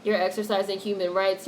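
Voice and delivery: ordinary delivery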